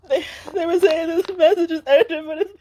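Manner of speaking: words breaking up as she starts to cry. Real, not acted. Reader to her viewers, suddenly crying. Breaks down mid tarot reading.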